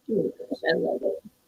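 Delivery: whispered, soft and breathy with delight; hushed, touched whisper; awed whisper. Real, not acted. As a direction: breathe it out, overwhelmed. Reacting to a message on stream.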